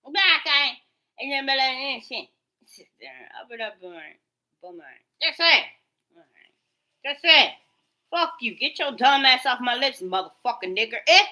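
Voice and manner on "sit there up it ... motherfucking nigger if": normal talking voice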